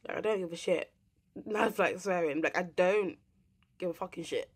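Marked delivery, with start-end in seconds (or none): none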